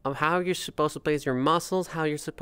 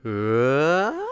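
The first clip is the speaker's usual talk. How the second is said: pitch glide up; 5 dB louder than usual. Shown as a demonstration. Voice coach demoing a pitch slide. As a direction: slide up without breaking. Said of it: "some exercise like".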